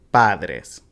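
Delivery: padres as PA-dres; stress on first syllable. Shown as a demonstration. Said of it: "you put the stress on the first one".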